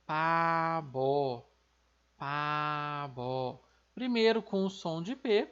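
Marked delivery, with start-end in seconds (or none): 0.1-3.6 "pabo" com P no início e B no fim; coreano pabo. Shown as a demonstration. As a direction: diga "pabo" devagar, P surdo e B sonoro. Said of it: "Por isso que a gente fala". (pronúncia coreana de "pabo", devagar)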